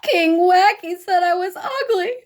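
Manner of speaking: high, loud tearful princess voice; sobbing. A narrator's reading. Honey sobbing to her father, high and tearful. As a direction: wail it through tears. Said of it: "Honey sobbed to her father".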